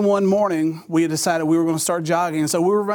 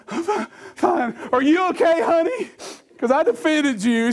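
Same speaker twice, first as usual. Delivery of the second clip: mock dazed hero; 10 semitones higher than usual. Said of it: "I was like".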